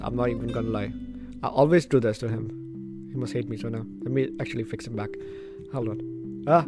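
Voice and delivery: ordinary delivery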